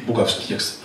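normal talking voice

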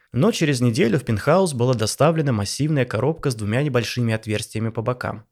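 ordinary delivery

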